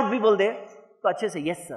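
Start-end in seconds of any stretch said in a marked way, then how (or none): 1.4-1.8 much lower, much softer submissive reply; meek yes sir. Retold or imitated. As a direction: shrink and mumble it humbly. Meek reply to angry boss.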